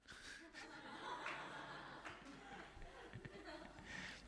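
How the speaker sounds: breathy silent laugh; quick airy chuckle; soft laugh. Real, not acted. Teacher laughs at own line.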